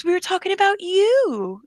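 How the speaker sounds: high, eerie retold reply with a falling tail; Siri's octave jump. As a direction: mimic it climbing high and stretching "you". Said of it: "his octave changed".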